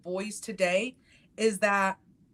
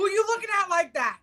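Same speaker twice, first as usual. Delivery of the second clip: higher, sassy challenge; mock confrontation; 11 semitones higher, 4 dB louder, faster than usual. Mom challenging her husband for side-eyeing her kid, sassy. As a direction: throw attitude at him, half joking.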